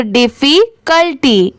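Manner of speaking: "Difficulty" slowly split as diffi, cul, ty; slow, in parts. Shown as a demonstration. Teacher joining three parts for learners, "difficulty" slowly in pieces. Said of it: "साथ में मिलाएंगे तीनों को".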